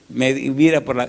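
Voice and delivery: ordinary delivery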